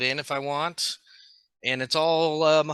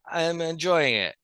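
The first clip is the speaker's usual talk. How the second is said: higher, put-on drunk rapper; mock rap, savouring each short phrase; 4 semitones higher than usual. Friend answering own candy rap, fat drunk rapper voice. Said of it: "drunk [name] is what you sound like".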